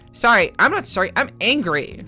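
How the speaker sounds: acted burst of irritation; angry retort. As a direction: push back indignantly, pitch jumping up. Character snaps back, declaring anger.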